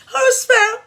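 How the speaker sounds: high, loud showy fake-proper English; mock posh talker. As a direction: parody someone putting on airs. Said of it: "I'll be forming fune".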